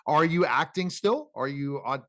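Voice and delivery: usual speaking voice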